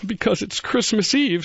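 high, sad little bunny voice. A narrator's reading. Bunny answers the wolf.

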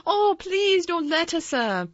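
high, loud, drawn-out plea; pleading. A narrator's reading. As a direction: beg the young master in an urgent, high-pitched servant's voice. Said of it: "pleaded Martha".

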